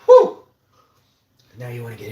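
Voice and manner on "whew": sharp loud exhale; loud whew. Real, not acted. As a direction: blow out a big whew. Reacts to strong garlic.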